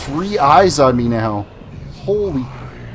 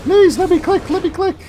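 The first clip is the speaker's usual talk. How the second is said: pleading whine. Real, not acted. Frustrated begging at game.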